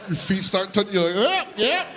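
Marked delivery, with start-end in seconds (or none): 1.2-1.9 put-on high squeaky little kid voice; meek child's surprise; high startled kid. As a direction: squeak it out while lifted. Feet touching ground again.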